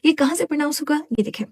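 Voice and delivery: ordinary talk, nothing marked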